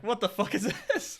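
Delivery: high, slow, drawn-out incredulity; mock disbelief. Comedian seeing their own stance on video, mock disbelief. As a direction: stretch it out high, half laughing, appalled at yourself.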